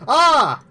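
sudden high frightened scream; startled scream. Real, not acted. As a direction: yelp in real fright. Ghost hunt, sudden fright.

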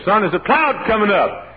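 high, loud imitation of Mama calling; mother's warning call. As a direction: call out to a son, storm approaching. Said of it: "Mama said".

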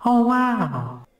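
high, drawn-out amazed exclamation; Owen Wilson. Acted. Comic showing off a catchphrase, Owen Wilson impression. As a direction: float the wow up high and let it fall. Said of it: "my Owen Wilson impression".

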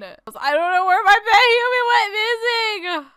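high, loud, whiny cry; mock-distraught wail. Player announces pet human missing, mock-distraught wail.

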